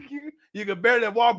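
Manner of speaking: normal talking voice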